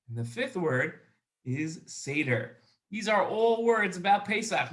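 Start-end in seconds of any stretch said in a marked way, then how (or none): 1.4-2.6 low, slow delivery; slow dictation. Shown as a demonstration. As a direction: say it slowly for someone writing it down. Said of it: "First time I'm gonna say it slow".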